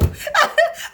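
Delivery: loud high burst of laughter; big high laugh. Real, not acted. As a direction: crack up at the memory. Laughing at own despair.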